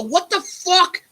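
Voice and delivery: high, incredulous yell; drawn-out, exasperated demand; retold angry outburst. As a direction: re-enact exploding at a neighbor. Retold quarrel with the neighbor.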